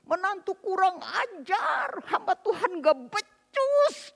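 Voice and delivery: high, drawn-out outraged yell; stretched high cry of outrage; loud angry shout. Retold or imitated. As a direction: shout slowly as an insulted father-in-law. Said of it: "dia langsung berteriak keras".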